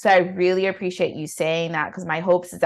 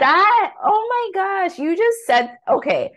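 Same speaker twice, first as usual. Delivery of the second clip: much higher, gushing with excitement; excited reaction; 13 semitones higher than usual. Real, not acted. Podcast host reacting to a guest's advice, excited. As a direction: burst out high in excited amazement.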